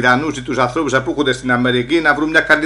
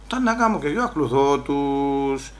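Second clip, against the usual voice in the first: ordinary delivery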